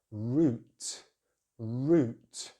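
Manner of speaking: root with long oo; British route. Shown as a demonstration. Teacher modeling British route. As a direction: round the lips for root. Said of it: "British English use the long vowel sound oo".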